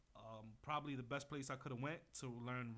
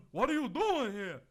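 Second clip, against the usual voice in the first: mocking impression of Johnny; 8 semitones higher, 12 dB louder than usual. Imitating the promoter Johnny rejecting him, high loud mockery. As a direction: ham up the boss brushing him off. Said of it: "and Johnny".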